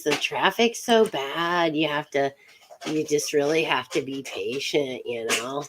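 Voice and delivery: laid-back put-on Californian accent. Shown as a demonstration. Host advising on visiting California, relaxed Californian accent. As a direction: play an easygoing Californian, in no hurry. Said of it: "I think I got the Californians down".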